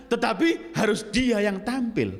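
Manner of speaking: sustained high-pitched sermon delivery; impassioned preaching. Real, not acted. Preacher exposing those who demanded a leader, high insistent preaching. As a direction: press the accusation, voice held high.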